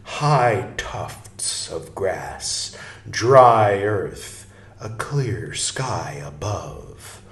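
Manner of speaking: slow, drawn-out storytelling read; stately narration with long, lingering words; slow read-aloud. Acted. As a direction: read it like a vision unfolding, savoring every phrase. Reads the book aloud slowly.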